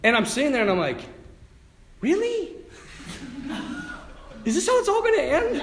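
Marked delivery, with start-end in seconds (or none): 2.0-5.6 very high, squeaky incredulity; hammed-up whine at an absurd fate; comic disbelief. Sinking priest questioning his end, squeaky disbelief.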